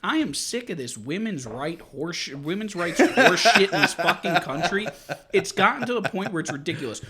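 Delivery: loud, fed-up tirade; heated rant. Real, not acted. Host launches into a heated rant.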